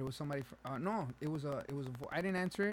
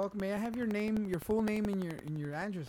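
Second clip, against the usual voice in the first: high, loud phone-agent voice; scam caller impression; 6 semitones higher, 5 dB louder than usual. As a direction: pitch it up as the agent. Acts out the scam woman.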